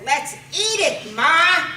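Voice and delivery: loud, drawn-out plea to Ma; eager kid's suggestion. Acted. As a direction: pitch it high, stretching the final word. Son pleading with mother in story.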